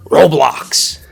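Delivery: ordinary talk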